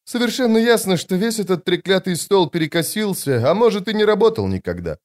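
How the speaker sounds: high-pitched, mildly impatient officer; lightly voiced exasperated commander. A narrator's reading. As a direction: lightly play a woman officer losing patience.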